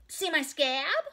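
put-on high, loud child show-off; proud little girl. A narrator's reading. Ramona shows off her scab, high, loud and proud. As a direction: boast it like a four-year-old. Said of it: "she said proudly".

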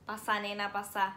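bored piercer. Retold or imitated. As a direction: say it like you hate your job. (la chabona aburrida, con desgano)

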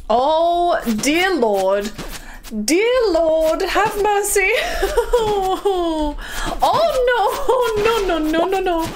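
high-pitched alarmed pleading with laughs; laughing panic. Real, not acted. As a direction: wail in playful horror at what appears. Alarmed reaction to monsters in game.

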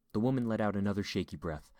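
plain reading voice

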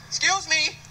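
usual speaking voice

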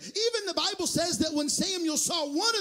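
loud, driving sermon cadence; full-force preaching. Real, not acted. Preacher telling the congregation how Samuel chose a king, full-force preaching. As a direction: preach it out, rising in volume then easing at the end.

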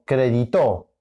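"crédito" wrongly stressed as cre-di-TO; stress on last syllable. Shown as a demonstration. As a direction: punch the final TO of "crédito". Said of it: "No, es crédito". («crédito» con acento en la última: cre-di-TÓ)

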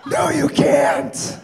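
loud, slow flat refusal; loud retort. Retold or imitated. Snapping back at cousin comparing babies. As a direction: shut her down hard and slow.

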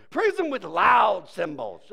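slow, 'loud' shouted and stretched; stress on loud. Acted. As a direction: blast the word 'loud'. Verse acts out word loud.

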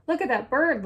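high, loud little exclamation; storybook chick calling out excitedly; excited cry. A narrator's reading. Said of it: "Little Chick cried".

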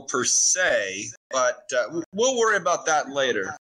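usual speaking voice

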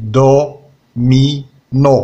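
Domino split slowly into do-mi-no; slow syllables. Shown as a demonstration. Teacher showing which syllable to circle, slow and clear. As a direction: drag out each syllable of Domino. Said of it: "Entoure la syllabe".

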